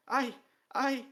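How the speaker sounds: high, loud, strained stammer; stammering in pain. A narrator's reading. Dwarf stammers under torture before the king, in pain. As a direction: choke on the words as the stone burns.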